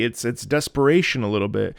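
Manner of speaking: ordinary talk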